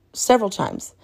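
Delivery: usual speaking voice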